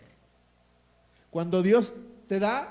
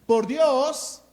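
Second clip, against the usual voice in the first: loud, slowed war cry; a full-voiced shout of the army; battle cry; 4 semitones higher, 8 dB louder, much slower than usual. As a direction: shout it like soldiers charging. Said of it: "Ellos gritan".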